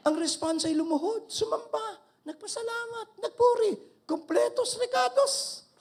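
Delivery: jubilant, laughing preacher; excited preaching. Real, not acted. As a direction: pile up the words with joy. Excited list of worship.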